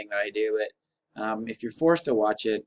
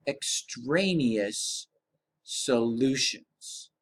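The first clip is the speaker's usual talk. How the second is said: drawn-out; 2 semitones lower, much slower than usual. Shown as a demonstration. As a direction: repeat it slowly and gravely. Repeat of the key term.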